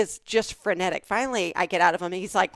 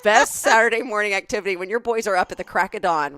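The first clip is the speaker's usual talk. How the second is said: louder, giggly mock enthusiasm; laughing while quoting; 3 semitones higher, 7 dB louder than usual. Wife quoting a friend's delight at the bug day, laughing. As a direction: gush through a laugh. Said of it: "She said".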